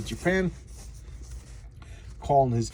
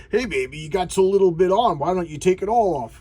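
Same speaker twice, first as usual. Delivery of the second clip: louder, higher mock catcall; leering party guy; 4 semitones higher, 6 dB louder than usual. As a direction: imitate a guy heckling a dancing girl.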